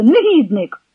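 sharp cry of contempt; outraged cry. A narrator's reading. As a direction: fling the insult out. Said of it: "скрикнула Зося".

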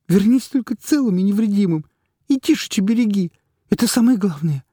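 high, loud, sobbing voice; sobbing plea. A narrator's reading. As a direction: plead through sobs. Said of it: "навзрыд произнесла Катька".

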